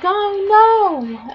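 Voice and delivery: high, loud cartoon wail; mock dismay. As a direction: cry out in exaggerated alarm. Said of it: "and they're like".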